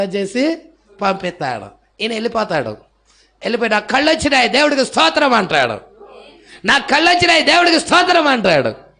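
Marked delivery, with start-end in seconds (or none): 3.7-5.3 mild retold shout. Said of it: "పెద్దగా కేకలు పెడతా వెళ్తా ఉంటాడు". 6.6-8.3 higher, louder mock cry of praise; retold joyful shout. Healed blind man shouting thanks to God, loud. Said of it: "పెద్దగా కేకలు పెడతా వెళ్తా ఉంటాడు".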